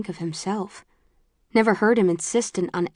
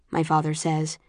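ordinary delivery

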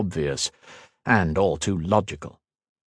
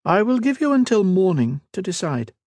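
high, poised royal voice, slowing at the end; a proud queen making a veiled threat; cool queen voice; 11 semitones higher than usual. Acted. The Queen's ultimatum.

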